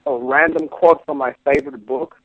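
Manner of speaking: hint of a Lebanese accent; lightly put-on Lebanese accent. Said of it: "shift in between, uh, urban American accent into, uh, Algerian".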